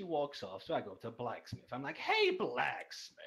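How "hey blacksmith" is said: high, drawn-out hail; loud, slow singled-out greeting; calling out. Acted. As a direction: call over to a shopkeeper, stretching it out. Acts out calling to the blacksmith.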